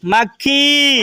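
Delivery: slow, stretched final vowel; drawn-out modelling. Shown as a demonstration. Teacher models an insect name for children to repeat, drawn out. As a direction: hold the last syllable long. चलिए बोलिए मेरे साथ.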